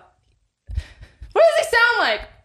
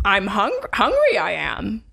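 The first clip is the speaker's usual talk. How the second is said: Yoda voice; 7 semitones lower than usual. Acted.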